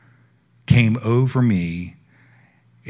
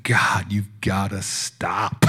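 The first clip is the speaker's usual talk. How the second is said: slow breathless whisper; whispered plea; 4 dB quieter, much slower than usual. Retold or imitated. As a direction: beg God in a hushed whisper. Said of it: "I said".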